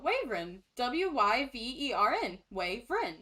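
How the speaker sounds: "wyvern" said as WAYV-rin, then spelled out; demonstrated family pronunciation. Shown as a demonstration. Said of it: "pronounces it wyvern".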